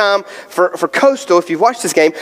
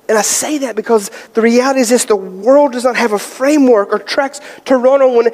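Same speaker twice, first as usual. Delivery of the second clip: high, fervent pulpit voice; building sermon delivery; preaching; 5 semitones higher than usual. Acted. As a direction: preach it to the church with conviction. Preaching to the congregation.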